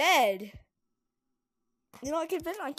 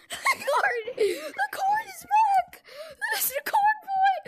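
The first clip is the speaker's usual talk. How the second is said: terrified horror victim; 4 dB louder, slower than usual. Pretend. Scared by the corn monster.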